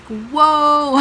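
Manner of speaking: high, loud retold "whoa"; reenacted startled exclamation; taken aback. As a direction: act out being thrown by an outburst. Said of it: "super taken back".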